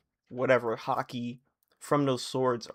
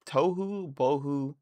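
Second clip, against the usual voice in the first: usual speaking voice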